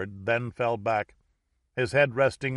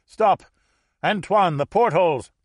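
loud, high warning cry; alarmed shout; 7 semitones higher, 7 dB louder than usual. Acted. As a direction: yell out a sudden warning. Alarm as attackers appear.

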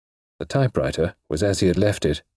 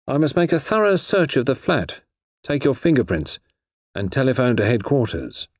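lightly voiced official, often higher pitched; dutiful inspector; 6 semitones higher than usual. A narrator's reading. Inspector to suspect before searching the flat, lightly acted officialdom. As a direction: explain your duty in a raised pitch.

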